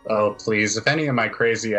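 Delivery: ordinary talk, nothing marked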